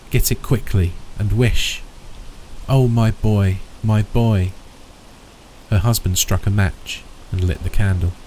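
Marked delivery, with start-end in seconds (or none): none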